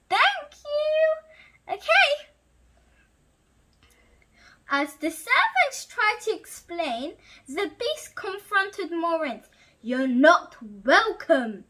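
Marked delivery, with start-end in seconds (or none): 0.1-2.3 much higher, louder happy thanks. Real, not acted. Teddy thanking his mother, much higher. 9.8-11.6 slow, louder menacing roar. Acted. Beast confronting Maurice, slow and louder. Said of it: "he roared".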